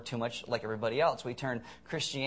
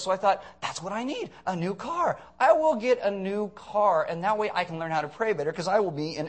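higher, mock-earnest bragging; sarcastic; 4 semitones higher, 4 dB louder than usual. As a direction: tell it eagerly as an oblivious believer, hammed-up. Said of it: "I'm gonna be really sarcastic".

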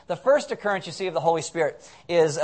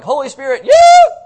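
screaming Holy Spirit yeah at the top of the voice; a jubilant worshipper's shout; wild cheer; 10 semitones higher, 16 dB louder, slower than usual. Retold or imitated. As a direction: whoop it like a party crowd. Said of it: "You get people who are like".

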